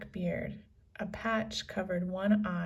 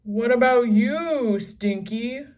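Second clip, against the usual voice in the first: loud gruff pirate call; roar; 3 semitones higher, 9 dB louder than usual. A narrator's reading. Captain calling up to Stinky, loud roar. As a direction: roar up at the crew. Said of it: "Cap'n Bones roared".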